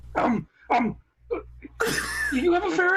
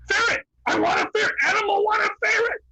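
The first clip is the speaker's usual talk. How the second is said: higher, louder demanding yell; Animal shout; 7 semitones higher, 4 dB louder than usual. Acted. Animal demanding a ferret from the group, shouting. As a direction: scream for the ferret like a wild drummer. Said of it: "give me characters from The Muppet Show".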